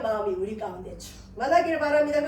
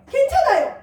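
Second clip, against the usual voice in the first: 괜찮아요 said much higher and louder; excited reassurance; 11 semitones higher, 7 dB louder than usual. Shown as a demonstration. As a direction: lift 괜찮아요 into a cheer. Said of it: "또 하면 돼요".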